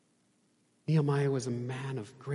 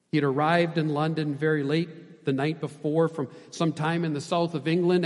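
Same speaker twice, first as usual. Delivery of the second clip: ordinary delivery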